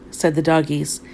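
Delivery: ordinary delivery, nothing marked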